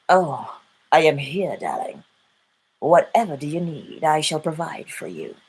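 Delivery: low, soft, seductive purr, "darling" dropping very low; a pretend lover cooing reassurance; sultry lover voice. Creation speaking to you as lover.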